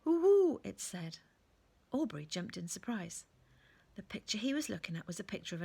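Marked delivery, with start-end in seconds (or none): 0.1-0.6 high, loud owl hoot. A narrator's reading. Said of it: "an owl answered".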